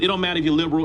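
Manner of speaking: usual speaking voice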